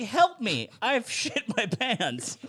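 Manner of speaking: high, slow, hammed-up plea; mock cry for help. Passenger begging a crowded train for help, mock distress. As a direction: plead high and drawn out, half laughing.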